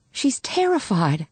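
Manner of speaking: high, slow, anxious appeal; pleading. A narrator's reading. Woman to officer about the frightened girl, pleading. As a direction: plead for her with restraint.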